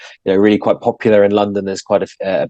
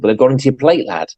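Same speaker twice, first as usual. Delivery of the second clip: a lightly voiced blunt old farmer, quick and higher; voicing the farmer; 5 semitones higher than usual. Guest retelling dad's story, the farmer answering the boy about the cows, lightly voiced farmer. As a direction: toss off the farmer's reply fast, a bit higher.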